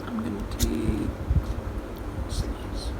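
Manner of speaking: soft breathy whisper. Real, not acted. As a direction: murmur it under your breath. Quiet aside while cutting cake.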